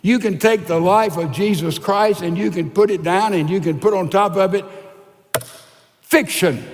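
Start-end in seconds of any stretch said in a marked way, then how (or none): 6.0-6.6 declared loud like a rubber stamp; stamped verdict. Shown as a demonstration. Preacher stamping a verdict on the story, loud. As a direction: stamp the word down hard. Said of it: "Stamp it".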